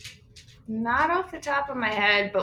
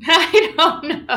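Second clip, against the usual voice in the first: laughing; 7 semitones higher, 10 dB louder, slower than usual. Real, not acted. Laughing at a teasing question.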